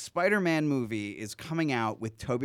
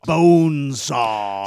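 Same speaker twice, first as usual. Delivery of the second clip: slow growled wrestler shout; Macho Man voice; 10 dB louder, much slower than usual. Retold or imitated. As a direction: draw it out like Randy Savage. Quoting Macho Man's Bonesaw line.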